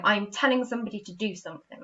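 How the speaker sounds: ordinary talk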